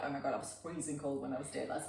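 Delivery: muttered aside. Retold or imitated. Retorting about cold weather.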